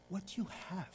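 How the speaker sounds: soft, hushed voiced question; Jesus's gentle whisper. Jesus asks the doubting believer what they have, softly whispered. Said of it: "Jesus whispers to you and say".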